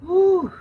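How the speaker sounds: high, loud breathless whew; winded whew. Real, not acted. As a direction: blow out the effort. Winded between exercises.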